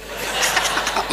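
a short amused chuckle; soft breathy laugh. Real, not acted. Preacher chuckling at his own joke, soft laugh. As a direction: laugh lightly at the punchline.

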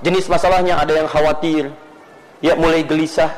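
ordinary talk, nothing marked

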